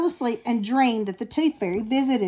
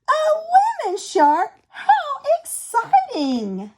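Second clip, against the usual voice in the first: excited exclaim; 14 semitones higher than usual. A narrator's reading. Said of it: "she shouted".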